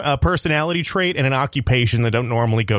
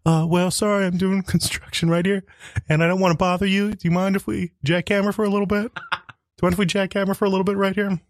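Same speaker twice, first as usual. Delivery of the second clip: higher, polite mild-mannered worker; an apologetic builder asking permission; quiet construction worker; 7 semitones higher than usual. Acted. Quiet construction worker asking to jackhammer. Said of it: "quiet construction worker".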